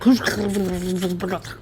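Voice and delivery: rolled, sputtering mouth noise; mock an unpronounceable name with gibberish noise; sputtered nonsense sound in place of a name; garbled imitation of town name. Shown as a demonstration. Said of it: "and it sounds like".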